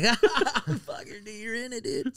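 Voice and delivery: high, squeaky laughing taunt; mocking laughing friend. Stoned friend laughing at his choking, high mocking voice. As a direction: imitate a friend cracking up at him. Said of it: "everybody's just, like, laughing".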